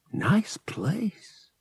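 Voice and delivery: breathy hushed whisper of amazement; awed whisper. Acted. Pilot marveling at the treasure room, awed whisper.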